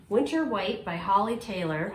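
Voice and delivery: ordinary talk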